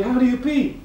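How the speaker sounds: very high, loud squeak; dwarf asking the man in a squeak; squeaky dwarf. Acted. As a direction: squeal the punchline. Dwarf punchline.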